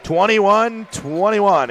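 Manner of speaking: high, loud, stretched-out numbers; drawn-out announcer score call; excited score call. Real, not acted. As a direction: proclaim the tie slowly and loudly. Announces tied score after basket.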